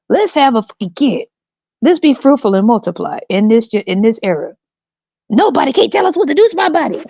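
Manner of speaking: high, mock-righteous parent voice; mocking parent rant. Comedian parodying parents' excuses, in a high, mocking voice. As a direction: parody a smug would-be parent. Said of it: "I want people to see how stupid this shit sounds".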